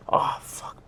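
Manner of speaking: unvoiced, under the breath; whispered frustration; whispered curse. Real, not acted. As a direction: hiss the anger out under the breath. Angry memory, whispered aside.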